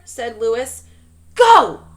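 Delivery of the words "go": a high, very loud roar. A narrator's reading. Coach roars the start of the race. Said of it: "roared the coach".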